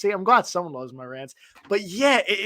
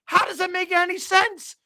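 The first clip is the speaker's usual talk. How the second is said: higher, incredulous retelling of the complaint; exasperated retold outburst; 12 semitones higher than usual. Retelling his outburst to the league commissioner, pitched-up exasperation. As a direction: replay the outrage at the league rules, pitched up. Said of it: "I'm like".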